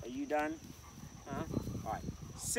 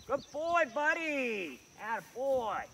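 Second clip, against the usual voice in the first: dog praise; 7 semitones higher, slower than usual. Real, not acted.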